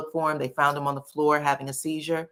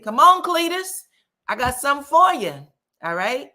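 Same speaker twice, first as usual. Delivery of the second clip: high, loud, fast comic Klumps taunt; a loud, fast, high-pitched comic heckle; Klumps impression; 11 semitones higher, 6 dB louder than usual. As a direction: ham up a Nutty Professor Klumps family heckle. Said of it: "Pulled a Klumps".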